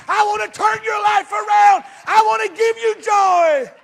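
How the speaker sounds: loud, high preacher's shout; preaching at full force. Acted. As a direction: throw every line out to the back row.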